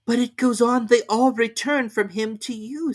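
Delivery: put-on high, girlish objecting voice; a bright girl correcting the king; Alice. A narrator's reading. Alice objects to the King, high-voiced. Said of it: "said Alice".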